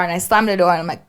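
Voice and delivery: ordinary delivery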